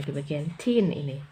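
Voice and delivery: ordinary talk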